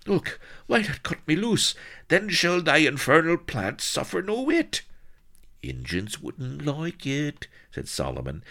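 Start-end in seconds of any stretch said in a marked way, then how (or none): 0.1-4.8 high, loud frantic demand; urgent outcry. A narrator's reading. Bound Blood demands to be freed. 5.6-7.5 high, slow country yokel; agitated rustic Solomon. A narrator's reading. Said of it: "dancing crazily in agitation".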